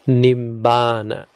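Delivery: "Nibbana" slowly, with long stressed BAA; stress on long syllable. Shown as a demonstration. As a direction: draw out the middle of "Nibbana", nib-BAA-na. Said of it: "so stress goes there".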